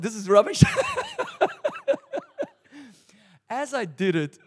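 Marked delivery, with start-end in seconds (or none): 0.0-0.6 loud, laughing mock verdict; laughing at his own bold act; laughing retort. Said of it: "I said". 0.7-2.5 real squealing laugh, high; laughter at his own confession; high burst of laughter. Real, not acted.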